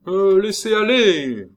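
high, loud drawn-out order; commanding shout from afar. A narrator's reading. Brigadier waving the stranger on from a distance, commanding shout. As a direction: call it out slowly across a distance. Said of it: "commanda de loin le brigadier".

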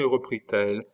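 ordinary delivery

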